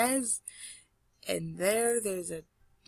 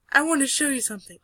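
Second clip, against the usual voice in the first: fast, high, louder character line; eager girl; 5 semitones higher, 5 dB louder, much faster than usual. Acted. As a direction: rush it out eagerly in a raised voice.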